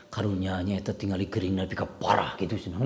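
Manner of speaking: soft, low, mock-pitying sigh of "parah"; mock pitying gossip. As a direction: imitate someone judging the sick while pretending pity. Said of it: "Eta intonasi nepi ka di gering". (nada iba yang dibuat-buat, pelan dan rendah)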